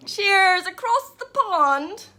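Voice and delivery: put-on a slow, half-hearted stab at a British accent; a barely there British accent, said slowly; failed British accent. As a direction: toast the Scottish viewer in a weak mock British accent. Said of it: "That was not even an accent".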